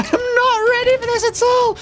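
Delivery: very high laughing squeal; panicked laugh. Real, not acted. Gamer laughs helplessly while monsters swarm him.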